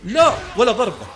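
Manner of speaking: real gamer frustration, loud; frustrated shout. Real, not acted. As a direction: shout in disbelief at the miss. Shouting at the game.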